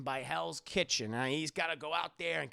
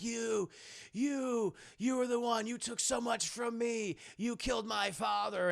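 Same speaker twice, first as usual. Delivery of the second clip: pitched-up, wounded accusation; accusing hero; 5 semitones higher than usual. Acted. Hero confronts his father's killer, accusing. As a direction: throw the accusation at him, voice raised in pitch.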